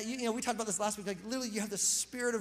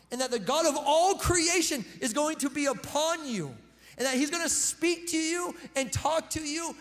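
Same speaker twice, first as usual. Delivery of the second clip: louder, higher, climbing exhortation; preaching at full force; 5 semitones higher, 4 dB louder than usual. Acted. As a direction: build to a shout for the congregation. Sermon climax.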